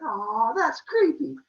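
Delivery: high, loud, drawn-out "aw"; cutesy creeped-out reaction. Retold or imitated. She answers Zeus's creepy compliment, high cutesy voice. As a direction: coo it high, as if flattered.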